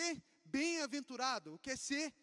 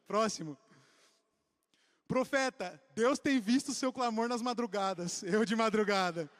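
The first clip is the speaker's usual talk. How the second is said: normal talking voice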